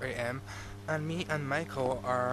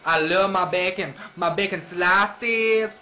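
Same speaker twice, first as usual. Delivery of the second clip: silly put-on voice; 4 semitones higher, 11 dB louder than usual.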